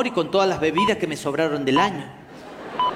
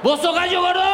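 high, slurred challenge; drunk challenge; 10 semitones higher than usual. Retold or imitated. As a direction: drunk brother squares up to the fat man, high and slurred. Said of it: "drunk brother answers".

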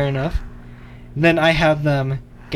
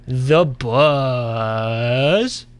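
stretched-out announcer tag, very slow, rising at the end; radio station ident; 2 semitones lower than usual. Pretend. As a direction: draw the station name way out like a radio jingle. Drawn-out station sign-off name.

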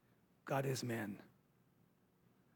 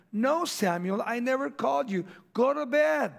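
impatient rebuke; 6 semitones higher than usual. Retold or imitated. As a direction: shoo the boy back to bed, exasperated. Eli sends Samuel back again.

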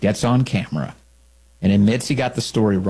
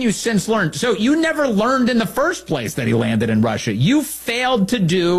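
incredulous mocking questions, pitched up; mocking outrage; 10 semitones higher than usual. Real, not acted. Host mocking a TV reporter's correction, incredulous scorn. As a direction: needle the reporter with scornful disbelief.

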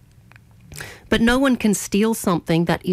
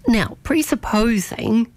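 ordinary talk, nothing marked

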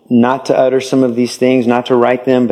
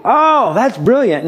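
drawn-out, high-pitched fake awe; mock admiration; 9 semitones higher, slower than usual. Listener to someone explaining their reasons, mock admiring gush. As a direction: gush slowly as if hugely impressed. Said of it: "And what do we do?".

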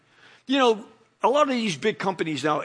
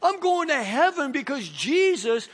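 fervent preaching; 4 semitones higher, slower than usual. Real, not acted. Preaching the answer with force.

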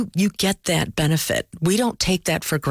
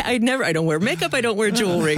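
usual speaking voice

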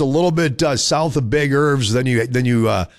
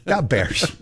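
put-on Chicago accent; 3 dB quieter than usual. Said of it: "That's, that's the accent".

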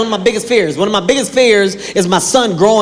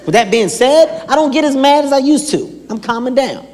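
normal talking voice

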